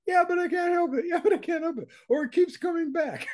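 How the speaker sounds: high-pitched mock excuse-making; mock protest, pitched far above normal talk; voice up an octave. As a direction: imitate helpless students in a put-on high voice. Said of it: "Your voice goes up an octave".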